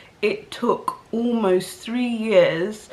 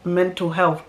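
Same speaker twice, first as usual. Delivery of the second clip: normal talking voice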